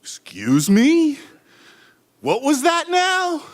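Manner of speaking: high, slow, disbelieving challenge; incredulous challenge. Retold or imitated. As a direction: dare him to say it again. Challenging the insult.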